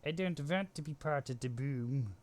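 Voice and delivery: a clumsy cartoon alien impression, half-hearted; playfully mimicking a Looney Tunes Martian; bad Martian accent. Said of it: "Sorry, that was a bad accent".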